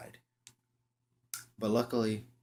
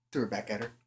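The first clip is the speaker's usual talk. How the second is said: ordinary delivery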